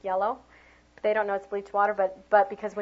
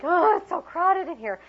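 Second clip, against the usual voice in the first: higher, lightly put-on fussy teacher; mock teacher complaint; 9 semitones higher, 5 dB louder than usual. Teacher imitating a colleague, mock fussy. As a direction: voice a fussing teacher, pitched up. Teacher voicing adult perspective.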